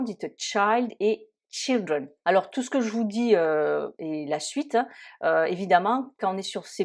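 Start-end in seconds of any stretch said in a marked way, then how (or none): 0.4-2.1 put-on slow "child" with ai then "children" with i. Shown as a demonstration. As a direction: stress the vowel change in "child" and "children". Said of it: "faites très attention".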